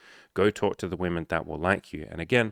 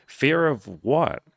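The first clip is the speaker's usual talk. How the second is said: drawn-out, higher, louder, each word leaned on; slow emphatic challenge; 6 semitones higher, 4 dB louder, much slower than usual. Real, not acted. Coach challenging an older man's fear, slow and emphatic. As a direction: drag it out like a dare.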